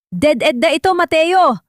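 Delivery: high, loud police command; shouted police warning through a megaphone. A narrator's reading. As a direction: bark the order to surrender over a bullhorn. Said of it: "malakas na boses mula sa megaphone".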